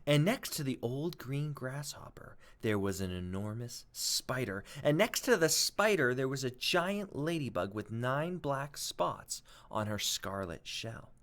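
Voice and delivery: usual speaking voice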